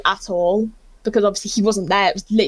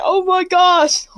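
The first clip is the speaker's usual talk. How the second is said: higher, drawn-out retold gasp of delight; impressed reaction; 7 semitones higher, 4 dB louder, much slower than usual. Star receiving a key ring gift, delighted drawn-out exclamation. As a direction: stretch it out, pleasantly amazed.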